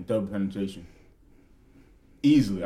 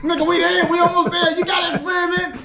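laughing shout; 8 semitones higher than usual. Retold or imitated. Acting out urging on the scene.

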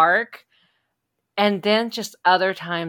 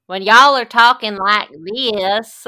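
slow, exaggerated Southern US accent, a bit louder; overdone Southern accent; 3 semitones higher, 8 dB louder, slower than usual. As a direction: lay the Southern accent on thick. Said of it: "will always overdo a Southern accent".

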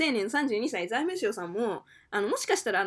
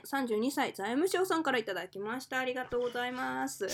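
ordinary talk

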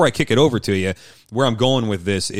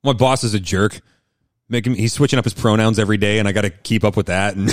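playing an angry husband in counseling; 2 semitones higher than usual. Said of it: "let's role play it".

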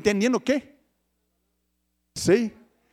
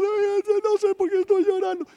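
mock happy weeping; 9 semitones higher than usual. Preacher imitating someone crying for joy in God's presence, mock tearful wail. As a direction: put on an overjoyed, weepy whine. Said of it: "estamos tan felices por algo y lloramos".

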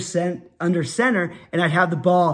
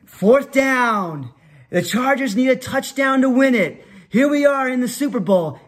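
higher-pitched play-by-play announcer; announcer mode; 6 semitones higher than usual. Retold or imitated. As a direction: call it like the Super Bowl's final play. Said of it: "I'd go into my announcer mode".

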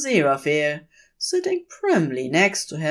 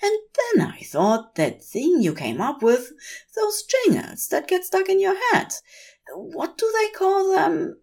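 much higher, delighted angel voice; Aziraphale. A narrator's reading. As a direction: greet him with fussy delight. Aziraphale explaining.

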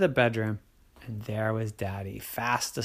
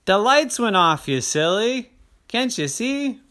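louder, higher teasing dad; 10 semitones higher, 7 dB louder than usual. Acted. Dad answers Terry from far away, lightly teasing. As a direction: tease the scared kid. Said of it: "his daddy said".

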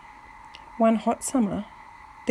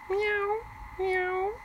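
high, soft mewing cat; cat meows; 12 semitones higher, 5 dB quieter than usual. A narrator's reading. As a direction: mew it softly up high like a cat. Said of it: "she purred".

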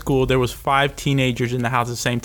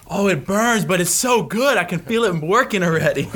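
mock excited coworker, pitched up; burning but pleased; 5 semitones higher than usual. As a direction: play a guy who loves the sting. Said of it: "one guy was like".

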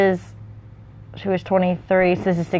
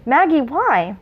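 put-on higher, questioning child; unsure, half frightened; 7 semitones higher, slower than usual. A narrator's reading. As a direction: ask with a hint of worry. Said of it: "wondering whether it should be frightened or not".

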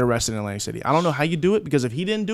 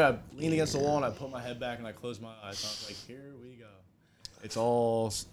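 very soft and low, trailing almost to nothing; hushed resignation; 4 semitones lower, 11 dB quieter than usual. Retold or imitated. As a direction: let it drop to a murmur, resigned to what is coming. Acting out his resigned moment, eyes closed.

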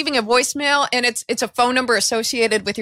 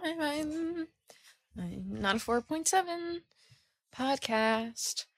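softer mock-wounded insistence; mock pout; 11 dB quieter than usual. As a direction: sulk that you're a five-star podcast. Joking pride about rating.

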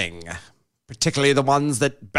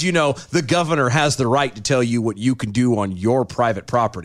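usual speaking voice

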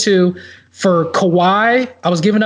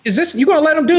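incredulous; 9 semitones higher, much faster than usual. Retold or imitated. Retold outraged question in trade story.

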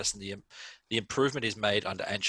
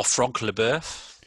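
French pronunciation; 2 semitones higher, much slower than usual. Pretend. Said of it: "sounds better when you put the accent".

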